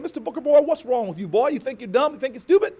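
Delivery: high, loud Southern teacher scolding; Southern accent. Retold or imitated. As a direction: confront a pupil in a Southern teacher's voice. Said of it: "the teacher looks at him".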